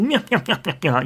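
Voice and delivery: evil villain chuckle; bad guy laugh. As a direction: cackle like a cartoon bad guy. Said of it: "really good bad, bad guy laugh".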